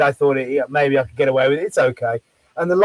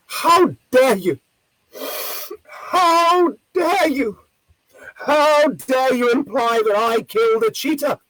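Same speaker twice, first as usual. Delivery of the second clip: imitating an indignant lady, high and drawn out; hammed-up offended outrage, stretching the words; 6 semitones higher, slower than usual. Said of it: "have this outrage".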